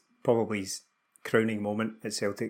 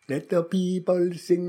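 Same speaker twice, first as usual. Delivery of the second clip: slow, high, half-sung in a mock Jamaican accent; terrible Jamaican accent; 7 semitones higher, 3 dB louder, slower than usual. Podcaster mimicking a player singing on the pitch, slow bad Jamaican accent. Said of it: "That's a terrible accent".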